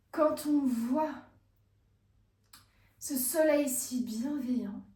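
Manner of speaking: slow, drawn-out admiration; savouring slowly. Acted. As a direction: linger over each phrase with long pauses. Woman basking, admiring her beach. (contemplation lente et savourée)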